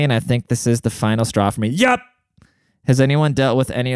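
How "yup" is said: emphatic yup. Real, not acted. Agreeing it's the final straw.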